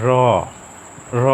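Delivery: র struck with a little force, tongue tip flapping down; teacher's firm, isolated র; forceful flapped R. Shown as a demonstration. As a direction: press on each র, leaving a gap. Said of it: "একটু জোর দিয়ে বলতে হবে".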